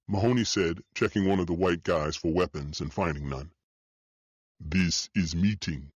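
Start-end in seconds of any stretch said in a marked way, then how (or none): 4.6-5.9 put-on slow, lower heavy Russian accent. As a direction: drag it out in a thick Russian accent. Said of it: "in a heavy Russian accent".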